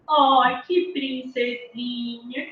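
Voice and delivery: soft, drawn-out cooing; talking to a baby girl. Acted. Adult to a crying baby in pink, soft slow baby talk. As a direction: coo slowly and softly over a baby girl. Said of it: "vai alguém e fala assim".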